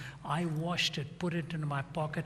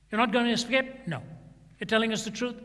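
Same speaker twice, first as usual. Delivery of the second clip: louder interrogation; 6 semitones higher, 7 dB louder, faster than usual. Retold or imitated. Guards interrogating the prisoner.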